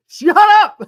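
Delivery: sudden high yelp; loud outburst. Real, not acted. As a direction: blurt it out laughing. Laughing protest at chat.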